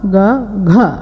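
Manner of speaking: first க as "ga", second as "gha"; g then aspirated g. Shown as a demonstration. Teacher contrasting letters, க as "ga" then "gha". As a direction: voice both க, add breath to the second. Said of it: "இந்த நான்கு உச்சரிப்புகளை".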